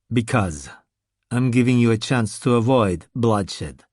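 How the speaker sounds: a big Russian warning a zombie calmly; calm Russian threat. Acted. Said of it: "the tone the big Russian was using".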